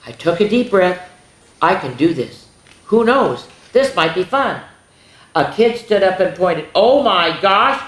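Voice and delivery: animated picture-book reading; storybook narration. Acted. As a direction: tell it to a class of kids. Reads the turkey's story.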